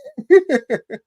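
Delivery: short, high burst of laughter; a real, high laugh. Real, not acted. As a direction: laugh out loud at the joke. Laughing at Southern impression.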